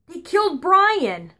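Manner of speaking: slow, high mock grief; grieving grandma. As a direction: break the news gravely. Grandma distraught over cartoon.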